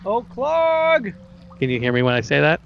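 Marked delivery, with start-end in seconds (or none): 0.1-1.1 high squeaky goblin calling out; a goblin calling out to his boss, pitched way up; Yeemik goblin voice. Acted. Goblin player calls out to Clark in a high goblin voice. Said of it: "make my voice into Yemix and go".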